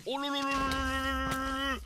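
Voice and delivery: high, loud tongue-trilled ululation; high mock trill. Real, not acted. Trilling at the monster to taunt it. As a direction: taunt the mob with a warbling lolololo. Playful trill at game enemy.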